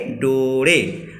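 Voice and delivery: डोरे drawn out very slowly, syllable by syllable; slow and careful. Shown as a demonstration. Teacher modelling the Kharia word for students, very slow. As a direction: stretch डोरे out so students can copy it. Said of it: "आ, थोड़ा सा आराम से बोलना है".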